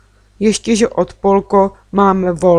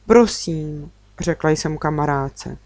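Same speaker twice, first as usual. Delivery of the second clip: plain reading voice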